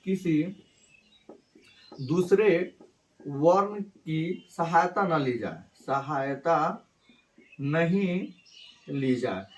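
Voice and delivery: slow, word by word for the class; slow dictation. Shown as a demonstration. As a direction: spell it out slowly so students can write. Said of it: "teacher dictating a definition". (धीरे-धीरे लिखवाना)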